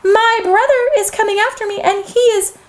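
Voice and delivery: high little goat voice, stretching each "so"; littlest goat pleading. Acted. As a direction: bargain as a small frightened goat.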